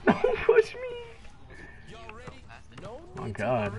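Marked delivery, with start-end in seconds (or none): 0.0-2.0 high, slow, crying whine; mock whiny cry. Shown as a demonstration. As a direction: whimper it out like a baby.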